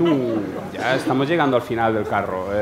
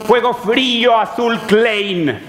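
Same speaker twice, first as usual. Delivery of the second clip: slow mock slogan; 10 semitones higher, 4 dB louder, slower than usual. Slow mock-solemn product slogan.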